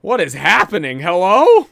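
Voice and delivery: loud, high baffled call; surprised. Real, not acted. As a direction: shout in disbelief at the chart. Baffled by wild price swings.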